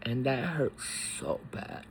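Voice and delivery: fighting back real tears; words fading into a breathless catch; choking up. Real, not acted. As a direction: voice breaking down to a whisper. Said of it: "I'm emotional about my grandfather".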